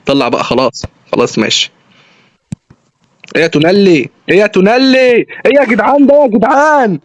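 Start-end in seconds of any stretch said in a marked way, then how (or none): 4.3-7.0 rising, high-pitched exasperation; real mid-game exasperation, pitch building; exasperated protest. Real, not acted. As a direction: protest at your players, climbing higher. Protesting at his players mid-match.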